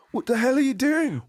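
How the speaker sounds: very high put-on voice; mock outraged character. Character waking Mia from a kiss, high mock outrage. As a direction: squeal the challenge in hammed-up outrage.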